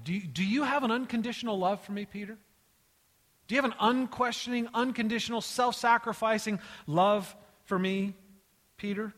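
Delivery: high, forceful probing question; digging in. Acted. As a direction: press hard on each weighty word. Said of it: "he digs in".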